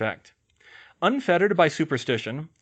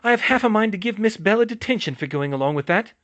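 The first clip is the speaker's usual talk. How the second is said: angry; 7 semitones higher, 5 dB louder than usual. Acted. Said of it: "said an angry Madame Pomfrey".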